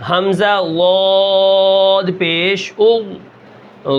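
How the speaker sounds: very slow حمزہ لاد پیش او with a thick ض; heavy ض. Shown as a demonstration. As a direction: draw it out and thicken it. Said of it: "موٹا کر کے پڑھنا ہے". (ض کو موٹا کر کے بہت آہستہ)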